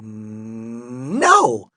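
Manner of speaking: "No" on a long-held n, bursting out loud; "No" swelling from held hum to shout; stubborn refusal. A narrator's reading. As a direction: hum the start of "No", then explode. Stubborn argument with cashier.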